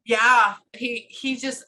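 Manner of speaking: ordinary delivery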